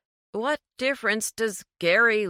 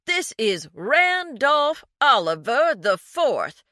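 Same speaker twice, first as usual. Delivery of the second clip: slow, loud, higher blue-blood bluster; pompous rich man, loud and slow; haughty snob; 7 semitones higher, 7 dB louder than usual. A narrator's reading. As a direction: announce your grand name in outrage. James acting a rich snob.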